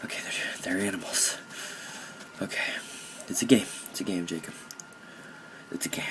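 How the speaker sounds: hushed, halting whisper to oneself; mock self-soothing. Gamer reassures himself it's only a game, hushed mock-emotional. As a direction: pretend to pull yourself together under your breath.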